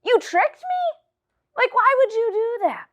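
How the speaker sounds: high shocked voice; mock outrage. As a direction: act betrayed and startled. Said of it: "Delilah's like".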